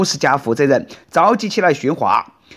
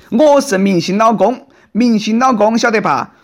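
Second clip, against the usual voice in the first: arrogant yelling; 5 semitones higher than usual. Retold or imitated. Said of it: "他还曾经这样叫嚣".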